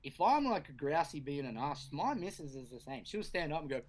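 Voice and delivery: usual speaking voice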